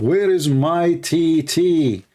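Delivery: slow, naive question; innocent question. Retold or imitated. Foreigner in the Philippines asking the office girls, slow and naive. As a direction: ask it slowly, not knowing the joke. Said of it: "I asked them".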